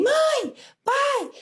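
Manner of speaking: very high piercing cry for help; screaming for parents. Retold or imitated. Girl being groped screams for her parents, piercing high yell. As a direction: shriek for mom and dad. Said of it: "E na hora eu gritei".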